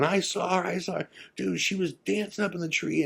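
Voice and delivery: high-pitched, imitated panicked chatter; freaking out. Ghost hunters regrouping at the road after the sighting, freaking out. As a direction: act out a friend's high, frantic babble. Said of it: "we're all freaking out".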